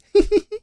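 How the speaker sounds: high bright woman's laugh. A narrator's reading. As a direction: laugh as if it's the funniest thing. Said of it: "she added with a laugh".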